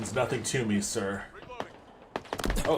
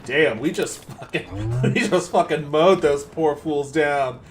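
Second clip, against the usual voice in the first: laughing; 3 semitones higher, 5 dB louder, slower than usual. Real, not acted. Laughing after mowing enemies down.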